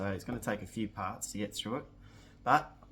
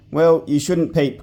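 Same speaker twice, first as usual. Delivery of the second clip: higher, louder snappish retort; angry pixie cry; 6 semitones higher, 16 dB louder than usual. Acted. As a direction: pixie scolding the girl who peeped. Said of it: "cried the angry pixie".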